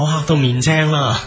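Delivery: normal talking voice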